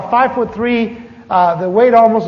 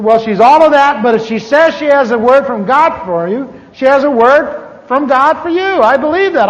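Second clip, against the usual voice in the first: louder, higher insistent friend; insistent pastor; 6 semitones higher, 6 dB louder than usual. Retold or imitated. Pastor Ray pushing John to listen, loud and insistent. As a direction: press the point hard.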